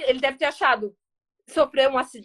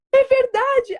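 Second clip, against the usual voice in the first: tearful realization; 10 semitones higher, 5 dB louder, much slower than usual. Retold or imitated. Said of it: "Aí eu choro-, comecei a chorar".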